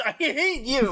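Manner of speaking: laughing mock outrage. Real, not acted. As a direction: protest in mock fury through laughter. Mock anger in a teasing dispute.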